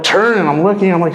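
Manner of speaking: ordinary delivery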